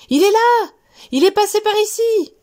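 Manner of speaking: high, loud excited shouting; children yelling. Acted. Children yelling to the teacher where the mouse is. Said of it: "Les enfants hurlent".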